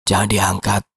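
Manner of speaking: lightly hoarse, heavy-voiced warning. Pak Yosep warning the guard off the phone, hoarse and heavy. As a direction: give the order in a weighty rasp. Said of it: "Pak Yosep berkata dengan suara serak dan berat".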